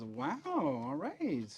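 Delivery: slow, drawn-out impressed wow. Acted. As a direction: sound genuinely impressed. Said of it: "impressed, and goes".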